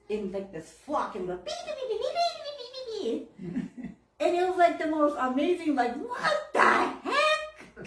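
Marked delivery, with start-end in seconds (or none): 1.4-3.9 very high squeaky beeps; flock chirping imitation. Flock of birds arriving, high chirping beeps. 6.0-7.9 high, slow, drawn-out amazement; stunned disbelief. Retold or imitated. Both women seeing the flock, slow high astonishment.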